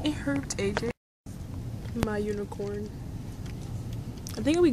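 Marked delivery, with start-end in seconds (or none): 0.0-2.9 hushed voice. Real, not acted. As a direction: keep it hushed so nobody hears. Hushed reply in the library.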